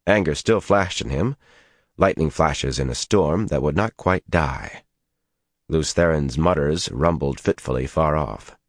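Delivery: usual speaking voice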